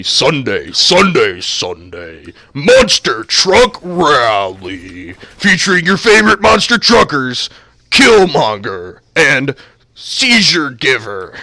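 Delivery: slow booming rally announcer; monster truck ad. Pretend. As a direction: drag out Sunday like a promo. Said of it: "it's gotta be like this".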